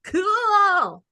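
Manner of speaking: mock enthusiasm. Woman answers the screaming signs, mock enthusiasm.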